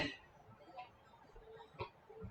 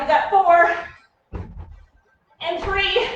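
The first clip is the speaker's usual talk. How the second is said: barking gym coach; 5 semitones higher, slower than usual. Real, not acted. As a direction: call out the final reps. Coach counting down the set.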